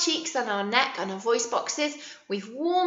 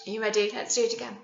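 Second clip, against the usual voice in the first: ordinary talk, nothing marked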